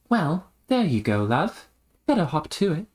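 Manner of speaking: high mannered reply; arch, genteel character. Acted. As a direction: urge them along with airy politeness. Other character replies.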